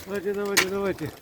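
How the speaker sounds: "давайте" called out high and bright, over and over; high calling out. Real, not acted. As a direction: call "давайте" up high to the birds. Calling pigeons to fly.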